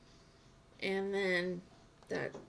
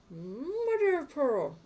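mock exclamation; 6 semitones higher, 5 dB louder, slower than usual. Exclaiming at game.

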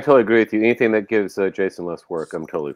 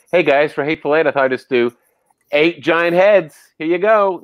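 hammed-up, naive presenting of an idea, rising higher; mock eager pitch; 5 semitones higher than usual. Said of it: "You're not going".

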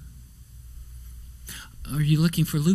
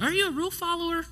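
high, quick teasing voice; prim rule-follower; 13 semitones higher, 4 dB quieter, much faster than usual. Acted. Preacher asking listeners about following rules, in high mock voice. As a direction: pipe it up fast in a mock goody-goody voice.

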